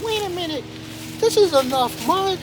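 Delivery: high, dawning realization; a small voice discovering hope; little muskrat realizing. Acted.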